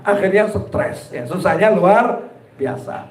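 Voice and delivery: usual speaking voice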